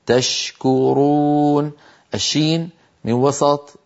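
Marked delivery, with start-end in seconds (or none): 0.0-1.7 slow recited تشكرون with ش and ك kept apart; clear shin. Shown as a demonstration. Teacher demonstrating izhar in تشكرون. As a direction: stretch تشكرون slowly, no merging. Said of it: "لذلك يؤمنون إظهار".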